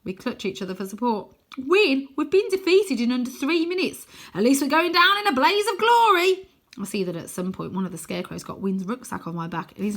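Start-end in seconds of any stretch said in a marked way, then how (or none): 1.6-6.5 high, loud, grand defeat; mock heroic. A narrator's reading. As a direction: declaim it like a doomed hero.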